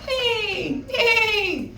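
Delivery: high whinnying horse cry. A narrator's reading. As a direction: squeal it like a frightened horse. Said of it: "they cried out".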